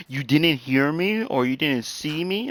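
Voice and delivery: slow, drawn-out imitation of a complainer; mock incredulous. Mimics an oblivious person's excuse.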